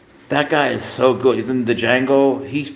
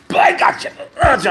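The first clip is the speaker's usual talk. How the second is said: breathy, then a high squeal of triumph; playful high gotcha at the cat; 12 semitones higher, 5 dB louder than usual. Real, not acted. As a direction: catch the cat and crow about it. Catches the pouncing cat.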